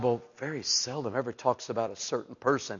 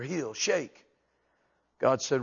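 ordinary talk, nothing marked

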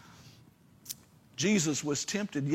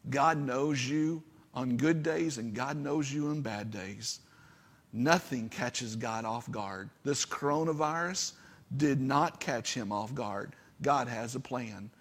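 ordinary talk

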